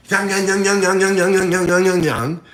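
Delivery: rhythmic playful punching sounds; lightly chanted punch sound effects; mock punch noises. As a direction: tap out the jabs with your voice. Miming punches in a fight.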